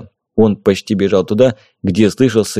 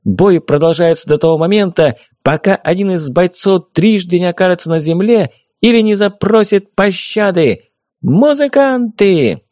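booming circus ringmaster; 7 semitones higher than usual. A narrator's reading. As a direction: announce the bout like a ringmaster. Fairy announces rules.

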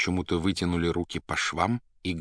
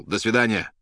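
loud, raised, dry farewell; curt, dry; 4 semitones higher, 6 dB louder than usual. A narrator's reading. Poet takes leave of the doctor, dryly. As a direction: say goodbye dryly. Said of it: "сухо сказал".

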